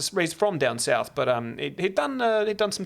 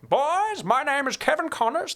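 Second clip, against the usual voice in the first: Kevin Connors; 12 semitones higher, slower than usual. Retold or imitated. Dorm master introduces himself to new boys, imitated high announcing voice. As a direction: put on a cowboy-style announcing voice. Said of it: "That's how he talked".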